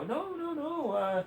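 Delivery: high, sugary fake-innocent excuse voice; mocking smug official. Politician smiling at the public while excusing civilian deaths, mock innocent. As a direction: play dumb in a high put-on voice, shrugging it off. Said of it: "you smile at us and go".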